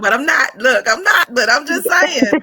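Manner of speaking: giggling through it, real joy; laughing. Real, not acted. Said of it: "I'm so full".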